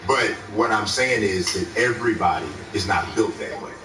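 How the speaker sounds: ordinary talk, nothing marked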